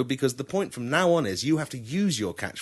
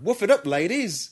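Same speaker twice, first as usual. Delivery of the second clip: higher, bright party cheer; cheerful, enjoy yourselves; 8 semitones higher, 3 dB louder than usual. Shown as a demonstration. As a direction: cheer the girls on for a night out. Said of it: "Like, enjoy yourselves".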